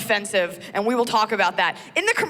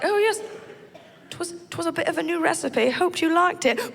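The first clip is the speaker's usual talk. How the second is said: put-on polite old-fashioned Brit; British gentleman; 6 semitones higher than usual. Husband answering wife at breakfast, mock British. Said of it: "He's like".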